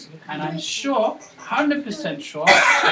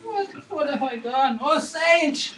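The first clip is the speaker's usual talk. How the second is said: higher, louder despairing wail; 6 semitones higher, 7 dB louder than usual. Acted. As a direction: cry out in despair to the sage. Son despairs, cries to sage.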